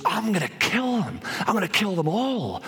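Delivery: high-pitched mock vengeful cry; retold rage. Goddess who just lost her lover vows revenge, retold rage. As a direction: act out an enraged goddess, without extra volume. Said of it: "now she's enraged".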